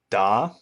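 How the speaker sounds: "da" sliding upward like a question; rising tone da. Shown as a demonstration. Teacher demonstrates second tone rising da. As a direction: lift the pitch through "da". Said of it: "increase or heighten the pitch".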